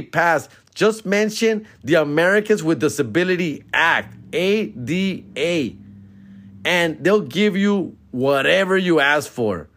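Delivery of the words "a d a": slow spelled-out letters. Shown as a demonstration. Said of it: "spelling out the letters".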